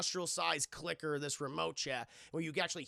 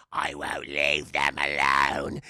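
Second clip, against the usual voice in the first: very low, slow, loud growl; drawn-out deep possessed voice; demonic spirit; 10 dB louder, much slower than usual. Retold or imitated. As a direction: answer like a menacing demon. Spirit answers the girl.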